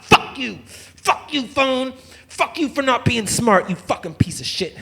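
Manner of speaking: high-pitched angry cursing at the phone; raging at phone. Acted. Cursing his smashed burner phone, in a high furious rant. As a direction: curse the phone out, drawn out.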